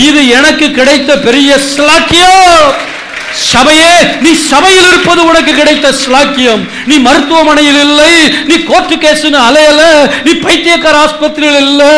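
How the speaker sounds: rally the church with rising fervour; fervent preaching. Real, not acted.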